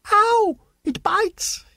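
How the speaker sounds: very high, drawn-out yelp; pained shout. A narrator's reading. Boy bitten reaching into the hole, shouting. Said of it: "he shouted".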